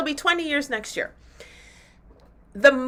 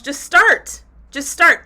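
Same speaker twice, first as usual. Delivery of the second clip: urgent push; 5 semitones higher, 6 dB louder, slower than usual. Real, not acted. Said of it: "If you wanna do the challenge".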